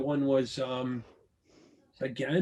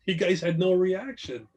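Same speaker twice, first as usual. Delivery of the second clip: usual speaking voice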